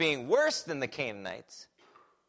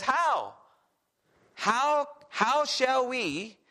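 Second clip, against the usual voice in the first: high, slow incredulous how; 7 semitones higher, much slower than usual. Retold or imitated. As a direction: stretch each how in disbelief. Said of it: "He says".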